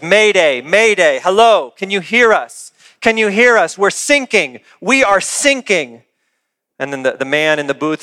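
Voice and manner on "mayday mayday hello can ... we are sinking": mock urgent Mayday call, louder; acted distress call. Sinking sailor calling the coast guard, acted urgency. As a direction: call out for help in short repeated bursts. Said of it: "There's a voice on the radio that says".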